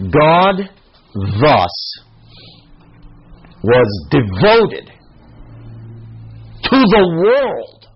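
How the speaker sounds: slow, word by word, each word weighted; slow emphatic reading. Shown as a demonstration. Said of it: "watch, watch this".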